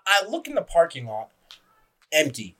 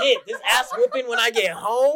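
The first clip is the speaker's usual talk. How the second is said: put-on kid in trouble; 5 semitones higher than usual. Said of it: "that kid who, like, got in trouble".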